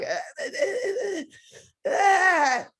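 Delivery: high wailing sobs, hammed up; mock crying. Actress telling how the director made her cry, mock sobbing. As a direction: imitate breaking into a high-pitched cry. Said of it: "I want you to cry".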